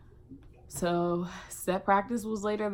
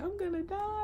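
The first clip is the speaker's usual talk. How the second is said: high, soft sobbing wail; 14 semitones higher, 5 dB quieter than usual. Retold or imitated. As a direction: whimper it out high and broken. Retold panic on bathroom floor.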